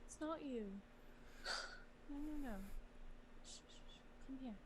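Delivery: soft, hushing comfort; soothing hush. Acted. Somi holding Kefris, softly hushing him to calm. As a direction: gently shush him down.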